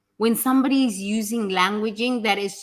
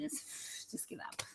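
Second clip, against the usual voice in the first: hopeless whisper; 3 semitones lower, 22 dB quieter, slower than usual. Retold or imitated. Voicing a hopeless depressed person.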